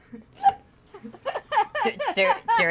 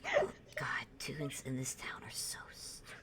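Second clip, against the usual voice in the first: whispered, weary and annoyed; exasperated whisper; 4 semitones lower, 15 dB quieter than usual. Acted. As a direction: mutter it under your breath. Guard muttering aside.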